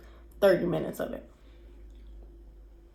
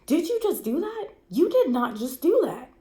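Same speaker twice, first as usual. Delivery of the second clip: incredulous repeating; 7 semitones higher than usual. Retold or imitated. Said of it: "I just kept repeating it".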